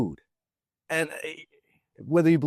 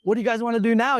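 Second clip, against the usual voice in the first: eager quote; 8 semitones higher, faster than usual. Retold or imitated. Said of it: "You're like".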